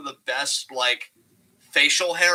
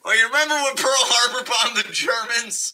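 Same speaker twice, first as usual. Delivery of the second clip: laughing impression; 7 semitones higher than usual. Mock Steiner rationale, laughing.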